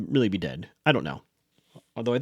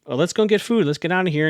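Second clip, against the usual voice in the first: acting weird; 6 semitones higher, 4 dB louder than usual. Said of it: "Wes gets all weird".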